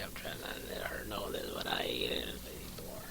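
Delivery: hushed confidential whisper. Pretend. Host confiding his liking for Lady Thor, whispering. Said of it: "Why are you whispering?".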